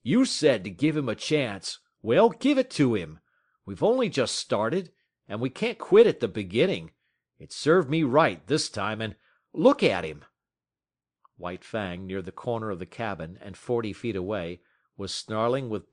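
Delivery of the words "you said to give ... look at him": louder, earnest pleading employer. Acted. Scott pleading with Matt to spare the dog, loud and earnest. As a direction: beg him not to quit now. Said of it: "it was now Weedon Scott's turn to plead".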